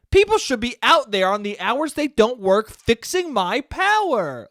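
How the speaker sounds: high, hammed-up bratty demand; little brat. Spoiled customer wanting power fixed after hours, little brat. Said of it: "what a fucking little brat I was being".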